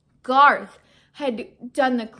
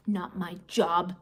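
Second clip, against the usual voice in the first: grumbling; 6 semitones lower than usual. A narrator's reading. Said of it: "she grumbled".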